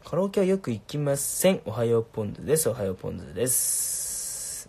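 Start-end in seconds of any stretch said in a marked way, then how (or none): 2.7-4.7 slow, with a long trailing "です"; drawn-out catchphrase. Pretend. Streamer repeating a catchphrase to viewers, slow and stretched. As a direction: stretch おはようポンズです, holding the final s.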